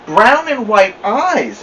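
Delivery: slow, high delighted exclamation; exclaiming. A narrator's reading. The friend exclaiming over Ramona's answer. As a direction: draw it out in amused surprise. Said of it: "exclaimed the friend".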